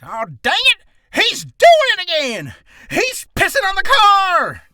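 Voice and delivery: high, shouted southern drawl; outraged old-timer yelling in a southern drawl. Acted. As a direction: holler it slow with a southern twang. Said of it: "with a southern drawl".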